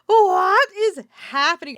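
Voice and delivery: high, loud, drawn-out bewilderment; shocked disbelief. Retold or imitated. Storyteller reacting to neighbors' uproar, loud drawn-out disbelief. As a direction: play up stunned disbelief, stretching the words.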